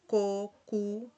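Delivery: ko, ku with a hard k; hard k syllables; Portuguese syllables. Shown as a demonstration. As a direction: clip out ko and ku. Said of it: "también se da en los casos K".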